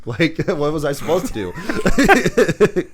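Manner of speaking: high, loud, drawn-out excuse through laughter; mock-helpless protest, slow and laughing; amused mock protest. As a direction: plead the excuse while cracking up. Acted excuse, cracking up.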